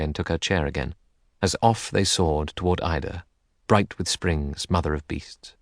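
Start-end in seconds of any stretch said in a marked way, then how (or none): none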